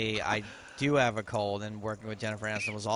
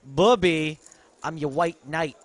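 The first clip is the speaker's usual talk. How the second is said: loud, higher-pitched Ellis-style movie impression; 7 semitones higher, 11 dB louder, slower than usual. Co-host compares him to Ellis in Die Hard, loud hammed-up impression. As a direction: belt it out as a cocky movie character. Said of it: "Ellis in Die Hard".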